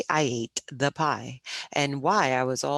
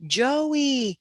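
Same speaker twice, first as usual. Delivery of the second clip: high, louder drawn-out holler; 12 semitones higher, 5 dB louder than usual. A narrator's reading. Teacher calls to the boy on the rafter, high holler. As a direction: call up to someone far above. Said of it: "she hollered and waved her hands".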